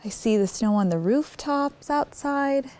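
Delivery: usual speaking voice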